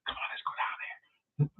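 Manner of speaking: slow whispered come-on; whispered horror victim. Retold or imitated. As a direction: lure your friend down in a hush.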